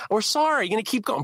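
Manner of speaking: high-pitched, exasperated sorry; exasperated apology. Retold or imitated. Neighbor accused of smoking out a woman, exasperated apology. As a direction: plead over someone who will not stop. Retold pleading with a screaming neighbor.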